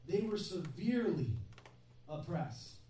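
severely stretched out, oppressed falling after a pause; stress on severely. Shown as a demonstration. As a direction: weigh down severely, then pause and drop on oppressed. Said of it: "the word severely isn't there just for".

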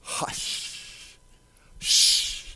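whispered hush with a long shh. Acted. Jesus speaking to the raging sea, whispered hushing. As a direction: breathe out a long, soothing shush. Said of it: "he said to the sea".

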